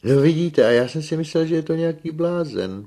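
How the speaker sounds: high, loud burst of confession; animated, high-pitched villager; amazed admission. A narrator's reading. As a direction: own up loudly to the mistake. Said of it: "přiznal se Filoxen".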